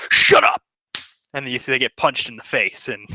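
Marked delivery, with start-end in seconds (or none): 0.1-0.6 sharp loud shout; soldier's yell. Acted. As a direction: bark it to silence the crowd. Soldier silences screaming patron.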